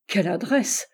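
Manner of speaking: plain reading voice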